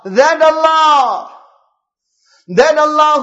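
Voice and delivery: slow, drawn-out shout; emphatic preaching cry. Real, not acted. Preacher stirring the congregation about Allah, drawn-out shout. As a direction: stretch the words out in a ringing call.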